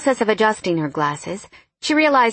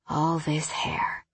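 whispering; 3 semitones lower, 8 dB quieter, much slower than usual. A narrator's reading. Lover murmuring during embrace.

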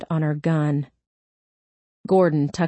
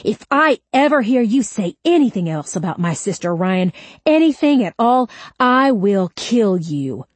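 furious threat; 4 semitones higher, 4 dB louder than usual. Acted. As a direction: let the anger burst out, then drive it home. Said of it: "her anger bursting like a grenade".

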